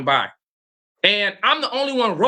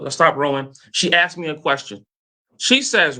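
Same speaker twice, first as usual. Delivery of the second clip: normal talking voice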